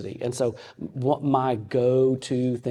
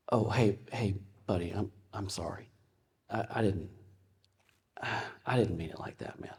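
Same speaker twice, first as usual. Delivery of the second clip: much softer, lower, gentle and sorry; soft apology; 4 semitones lower, 8 dB quieter than usual. Retold or imitated. Father apologizes gently to son, low and soft. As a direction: back down and say sorry softly.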